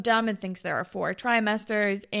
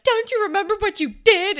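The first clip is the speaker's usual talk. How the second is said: high, loud mock confrontation; mock accusing; 10 semitones higher, 7 dB louder than usual. Retelling Claire confronting Fitz, mock accusing. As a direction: throw it back in an exaggerated accusing voice.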